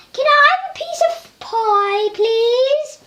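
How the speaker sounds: a tiny toy Vader begging politely for pie; high squeaky villain asking nicely; Darth Vader voice. Pretend. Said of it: "Darth Vader comes on, like".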